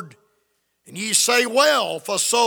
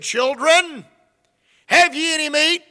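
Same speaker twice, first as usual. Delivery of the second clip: calling out; 6 semitones higher, 5 dB louder than usual. Acted. The Lord calling from shore.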